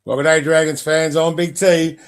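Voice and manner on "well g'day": usual speaking voice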